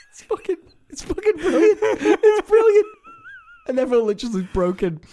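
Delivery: high, loud talk through laughter; helpless giggles while praising; laughing. Real, not acted. Friend laughs while praising a comedian's sketch, laughing hard.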